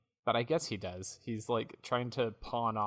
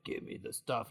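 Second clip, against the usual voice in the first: unvoiced, quieter menacing demand; villain voice; 6 dB quieter than usual. Big man demanding the stuff from Jeeves, villain voice. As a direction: rasp it as a mock thug.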